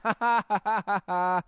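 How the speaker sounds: giddy, unhinged laughing fit; manic laugh. Real, not acted. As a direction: laugh like you're losing it. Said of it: "I'm losing my mind a little bit".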